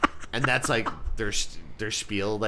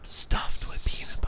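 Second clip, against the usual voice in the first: sexy whisper. Pretend.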